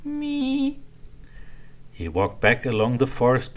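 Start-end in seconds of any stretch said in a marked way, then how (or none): 0.0-0.8 high whispered squeak; a tiny breathy creak as the gate shuts. A narrator's reading. Hippo closes the castle gate, whispered high squeak. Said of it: "it squeaked shut".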